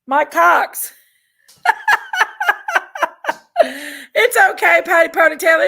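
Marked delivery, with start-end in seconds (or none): 1.6-4.1 shrieking burst of laughter; high laughing fit. Real, not acted. 4.1-5.6 talking through laughter; words bubbling with laughter; laughing. Real, not acted.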